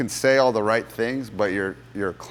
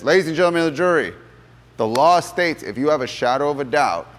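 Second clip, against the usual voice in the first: raised, fast lawyer's address; loud courtroom address; 5 semitones higher, 6 dB louder than usual. Retold or imitated. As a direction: address the whole jury loudly and fast. Attorney stands to address jury.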